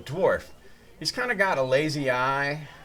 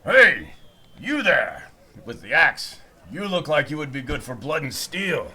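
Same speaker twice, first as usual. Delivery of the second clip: half-orc recruiter; 7 dB louder than usual. Acted. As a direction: call out to the tiefling with the axe like a brute mercenary. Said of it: "And he's like".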